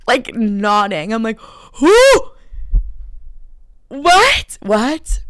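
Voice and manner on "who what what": high, loud, incredulous "what?"; stunned, high-pitched outburst; shocked disbelief. Retold or imitated. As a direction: yelp it out in re-enacted shock. Acts out shocked reaction to the reveal.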